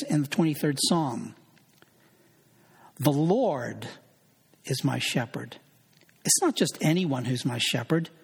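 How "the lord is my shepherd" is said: "Lord" stretched out and set apart by a pause; stress on Lord. Shown as a demonstration. Preacher demonstrating Bible study to the congregation, stress on "Lord". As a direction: lean on "Lord", then drop off for the rest. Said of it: "emphasize different words in a given verse".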